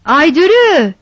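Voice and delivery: calling loudly for the missing wife. A narrator's reading. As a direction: shout her name across the yard. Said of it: "diye bağırdı".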